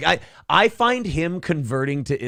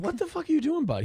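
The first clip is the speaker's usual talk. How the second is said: high, teasing scold to a pet; pitched-up mock scolding of the dog; talking to the dog; 5 dB quieter, faster than usual. Real, not acted. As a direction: owner scolds the dog crawling on the desk, pitched up.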